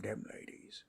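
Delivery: breathy admiring whisper. Acted. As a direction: drop to a whisper. Admiring aside about orc women.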